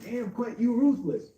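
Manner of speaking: high voiced chat quote, loud at end; chat reaction. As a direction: hype it up like the chat. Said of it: "people in the chat was like".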